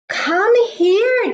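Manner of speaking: high, loud call; mother duck shouting come here. Acted. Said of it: "shouted Mama Duck".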